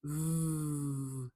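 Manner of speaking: long buzzing English "V."; English V sound. Shown as a demonstration. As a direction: hold "V." with friction, lip on teeth. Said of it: "It is different than your V".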